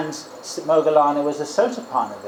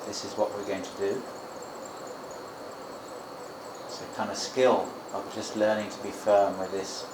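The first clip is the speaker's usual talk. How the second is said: low, soft, steady meditation talk; guided meditation; 4 semitones lower, 7 dB quieter than usual. Shown as a demonstration. Teacher urging meditators to be firm with the mind, low and soft. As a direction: coach the mind quietly, with unhurried pauses.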